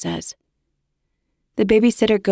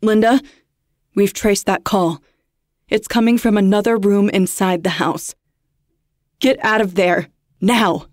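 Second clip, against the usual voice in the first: louder, pressing warning; urgent; 5 dB louder than usual. Acted. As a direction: rush her out, urgent command. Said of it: "His voice is urgent".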